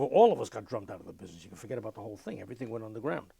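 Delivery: ordinary talk, nothing marked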